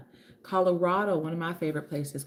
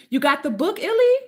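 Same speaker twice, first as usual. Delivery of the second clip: loud, high excited question; real, excited exclamation rising high; thrilled surprise; 8 semitones higher, 8 dB louder than usual. Real, not acted. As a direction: squeal it upward in delighted disbelief. Thrilled a viewer has the book.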